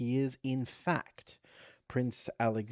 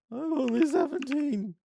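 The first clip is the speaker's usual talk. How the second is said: put-on squeaky young man voice; 7 dB louder than usual. Comic reply as the young hero.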